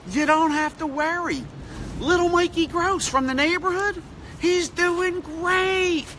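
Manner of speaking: high, slow sing-song reassurance; ninety-year-old mother reassured by her son; mother's baby boy. Retold or imitated. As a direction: coo it like a proud little boy to his mother. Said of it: "And so I said".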